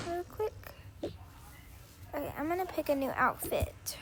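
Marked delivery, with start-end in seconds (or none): 2.1-4.0 whisper. Real, not acted. Quietly narrating doll play.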